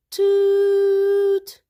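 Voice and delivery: long, very high, loud honk; truck horn toot. Acted. Father's truck horn imitated.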